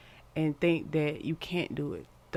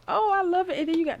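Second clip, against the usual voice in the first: delighted squeal; 14 semitones higher, 6 dB louder, faster than usual. Real, not acted. Delighted by the cards.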